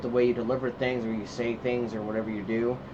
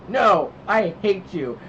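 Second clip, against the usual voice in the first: mild mock tantrum, higher and drawn out; child's tantrum imitation; 6 semitones higher, 4 dB louder, much slower than usual. Dad retelling his son's reply about the tablet, mock defiance. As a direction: imitate a defiant kid, lightly.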